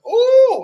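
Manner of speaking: sudden high loud yelp; mock startled shriek; yelp of alarm. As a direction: cry out as the cat lashes out. Retold cat attack.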